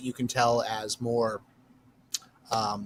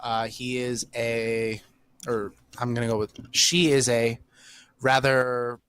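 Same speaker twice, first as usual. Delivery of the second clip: ordinary delivery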